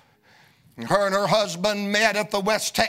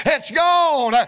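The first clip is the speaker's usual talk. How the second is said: "gone" pushed higher and louder with a falling end; louder repeat; 6 semitones higher, 5 dB louder than usual. Acted. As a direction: hammer it home the second time. Repeated declaration building.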